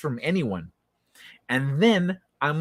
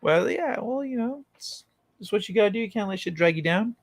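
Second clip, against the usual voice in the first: lightly voiced stoned friend; 6 semitones higher than usual. Stoned friend replying casually.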